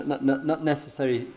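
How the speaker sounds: ordinary delivery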